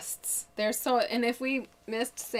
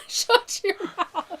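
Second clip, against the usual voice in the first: high, slow and laughing, much louder; laughing echo; 10 semitones higher, 5 dB louder, much slower than usual. Acted. Cohost laughing back at the host's mock outrage. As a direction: echo the joke through big laughter.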